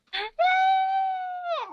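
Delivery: squeaky, high-pitched laughing noise; weird high laugh. Real, not acted. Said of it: "I'm making weird noises".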